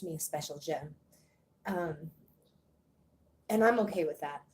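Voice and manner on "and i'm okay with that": normal talking voice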